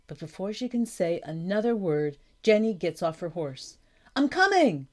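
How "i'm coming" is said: high, loud rescuing call; urgent shout. A narrator's reading. Girl calling to the drowning boy, urgent shout. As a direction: call out high to someone in danger. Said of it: "she shouts".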